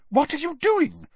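very high-pitched disbelieving question; appalled. A narrator's reading. McGonagall watches the bouncing ferret, appalled. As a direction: demand it in high-voiced alarm.

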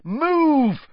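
high, loud frantic shout; desperate shout at a statue. Acted. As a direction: yell at the stone gargoyle. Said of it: "Harry shouted at it".

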